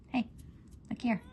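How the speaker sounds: normal talking voice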